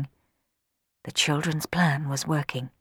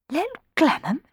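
Little Glenham asked much higher, rising in surprise; surprised exclamation; 11 semitones higher than usual. Acted. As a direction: echo Little Glenham in disbelief. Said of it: "exclaimed Rowan".

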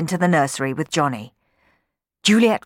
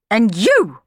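stern turn; 6 semitones higher, 7 dB louder than usual. Acted. Housekeeper turns on the sweeps.

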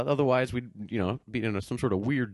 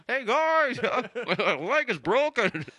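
high squeaky hurt animal voice; high cartoon dog voice; 10 semitones higher, slower than usual. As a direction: cry up from the well, high and silly. Voicing the dog in the well.

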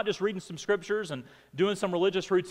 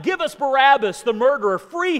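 loud, high shout of the mob; crowd shouting; 8 semitones higher, 8 dB louder than usual. A narrator's reading. Preacher voicing the crowd demanding Barabbas, loud shout. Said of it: "They shouted".

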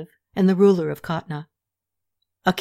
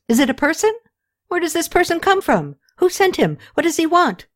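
high-pitched, insistent string of questions; urgent questioning; 8 semitones higher than usual. A narrator's reading. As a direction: press for answers, pitched well up. Queen fires questions at news.

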